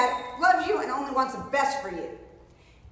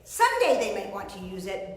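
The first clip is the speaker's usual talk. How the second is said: usual speaking voice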